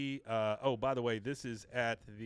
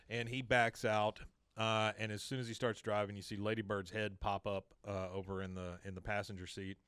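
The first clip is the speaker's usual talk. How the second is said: ordinary talk, nothing marked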